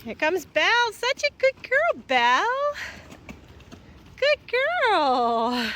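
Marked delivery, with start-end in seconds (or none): 0.9-2.7 high sing-song cooing; cooing praise. Real, not acted. Farmer praising her cow Belle, high cooing. 4.2-5.7 slow, drawn-out call; calling the cows. Real, not acted. Farmer calling her cows to the gate, slow call.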